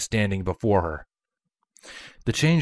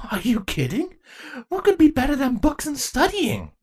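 gleeful. Acted. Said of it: "eyes glinted gleefully".

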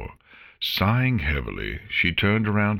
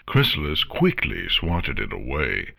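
plain reading voice

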